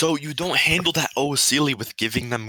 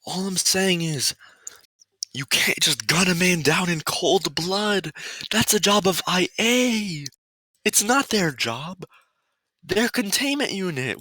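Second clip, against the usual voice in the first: over-the-top rant; 9 semitones higher than usual. Acted. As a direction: rant in a strained high voice. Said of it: "that sounded exactly how I wanted it to sound".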